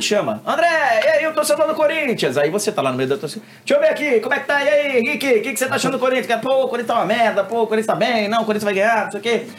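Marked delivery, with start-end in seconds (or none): 0.4-2.4 high, loud radio caller voice; mock announcer call. Narrator calling the crowd reporter on air, hammed-up. 3.6-9.5 high, brisk radio reporter voice; mock field reporter. Reporter interviewing a fan in the crowd, hammed-up.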